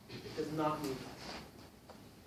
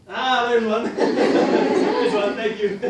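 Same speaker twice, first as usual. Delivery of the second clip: loud, high burst through laughter; delighted catch, laughing out loud; 5 semitones higher, 15 dB louder, much slower than usual. Real, not acted. Teacher catches a student's bad habit, laughing loudly.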